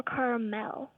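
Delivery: caramel in three syllables, ending in MEL. Shown as a demonstration. Young caller asking the hosts which is right, caramel as CARE-uh-mel. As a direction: say caramel as CARE-uh-mel, every vowel kept. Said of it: "Is it pronounced".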